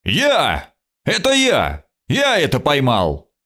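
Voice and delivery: mild narrated shout, pitch jumping up; eager claim called out in short bursts. A narrator's reading. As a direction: cry out to claim the credit. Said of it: "прокричал".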